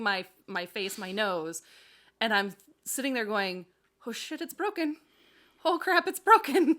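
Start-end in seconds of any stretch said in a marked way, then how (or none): 4.1-6.7 panicked. Retold or imitated. Retold panic over broken nose.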